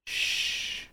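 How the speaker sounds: a soft voiceless shush; whisper. Retold or imitated. Retelling the game's ghost hushing the hero, whispered. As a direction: hush him like the woman in the cutscene. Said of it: "just goes".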